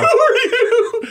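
high, slow, laughing mimic of the owner; quoting the owner through laughter.

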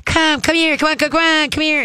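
high sing-song come here to the dog; high coaxing call. Shown as a demonstration. Trainer showing owner how to call the dog, high and coaxing. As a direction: coax the dog in with a bright high voice. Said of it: "you're gonna squeak it and go".

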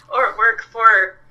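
strained, slow after choking. Real, not acted. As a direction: push words out past a cough. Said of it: "I'm choking".

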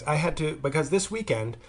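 ordinary talk

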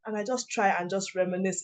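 ordinary talk, nothing marked

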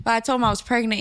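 ordinary delivery

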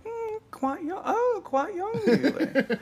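Miss Slocum imitation. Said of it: "he was like".

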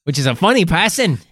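put-on Aussie voice, hammed-up; mock Australian accent. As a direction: lay on a cheesy Australian accent. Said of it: "we know you love this authentic Australian accent".

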